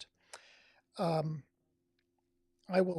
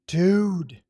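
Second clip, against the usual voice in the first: loud, drawn-out gasp of a word; stunned by how beautiful it looks; amazed exclamation; 12 dB louder than usual. Real, not acted. Streamer seeing a stunning photo, loud amazed exclamation.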